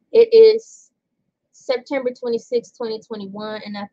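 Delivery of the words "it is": sudden loud emphasis. Real, not acted. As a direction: punch it out loudly. Loud emphatic reading aloud.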